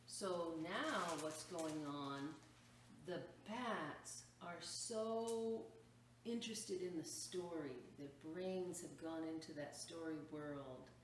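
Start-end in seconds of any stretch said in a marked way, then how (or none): none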